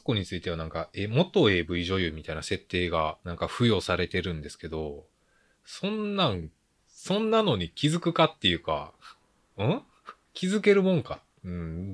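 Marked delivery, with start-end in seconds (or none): 5.7-11.2 high, puzzled disbelief; incredulous. Real, not acted. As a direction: question it in disbelief. Incredulous questioning.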